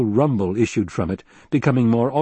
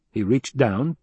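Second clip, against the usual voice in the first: ordinary delivery, nothing marked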